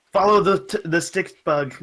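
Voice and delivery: high, loud rallying call breaking into laughter; hammed-up shout to follow; mock yelling. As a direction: playfully yell an order at the kids. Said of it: "you're yelling at them to go".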